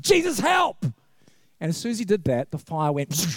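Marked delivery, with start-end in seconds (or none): 0.0-0.8 yelped high and sudden in a panic; a burst of alarm, voice leaping high; panicked cry for help. Retold or imitated. Curate on fire crying out to Jesus, panicked high yelp. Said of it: "And he said".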